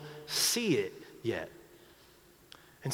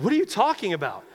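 higher, louder, fast disbelief; stunned, raised-voice retort; shocked outburst; 7 semitones higher, 6 dB louder, faster than usual. Retold or imitated. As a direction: fire it back in disbelief. Rocky's shocked retort to the priest.

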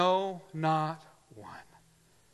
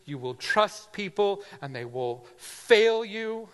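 building preaching cry; 6 semitones higher, 7 dB louder than usual. Real, not acted. Sermon climb to full force.

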